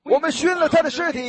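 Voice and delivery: shouted mockery; mocking jeer. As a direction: jeer at him like a scoffing crowd. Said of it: "他们就耻笑他".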